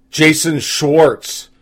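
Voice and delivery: ordinary talk, nothing marked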